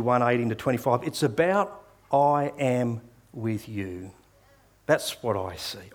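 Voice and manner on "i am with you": drawn out very slowly, each word weighed; preacher lingering on the sermon's key line for the congregation; slow emphatic repetition. Shown as a demonstration. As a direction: stretch the words so they sink in. Key phrase slowed.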